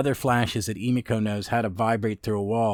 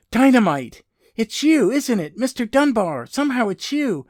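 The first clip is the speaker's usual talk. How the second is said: gasp, then high, loud, delighted exclamation; amazed recognition; 10 semitones higher, 6 dB louder than usual. Acted. Emiko recognizes Dynamite, gasping in high, loud amazement. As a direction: act out a stunned, high-pitched reunion cry. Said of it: "it's a great moment. She goes".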